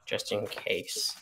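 soft, slow, whispered in-character line; quiet character line. Acted. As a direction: hand it over quietly. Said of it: "and I'm gonna say".

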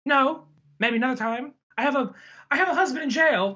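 high-pitched acted refusal; imitated woman's brush-off. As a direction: turn him down in a high mock-female voice.

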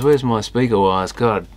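ordinary delivery

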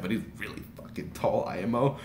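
usual speaking voice

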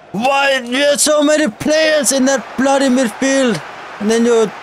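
exasperated shout at his own team; frustrated yell. Real, not acted. Angry at team's midfield.

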